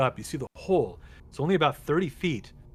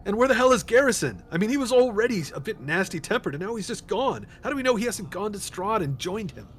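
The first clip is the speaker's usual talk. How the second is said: higher, suspicious, worried; continued character rant; 7 semitones higher, 4 dB louder than usual. Acted. Esmeralda fretting to the party about Garrison, high and worried. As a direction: keep Esmeralda worried and raised.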